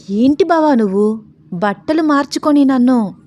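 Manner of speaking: high, loud, embarrassed objection; bashful protest. A narrator's reading. Sita asked for a hug before changing, bashfully protesting. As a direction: protest shyly at his boldness. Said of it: "సిగ్గుపడుతోంది Sita".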